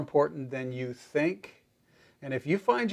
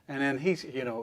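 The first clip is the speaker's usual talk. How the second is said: normal talking voice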